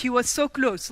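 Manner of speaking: normal talking voice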